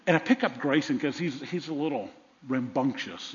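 normal talking voice

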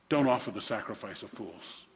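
normal talking voice

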